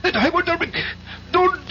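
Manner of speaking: high, panicked cries; terrified pleading. Acted. Igor pleading with Rojansky not to inject him, terrified. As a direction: beg for your life as the needle comes. Being killed with a hypodermic.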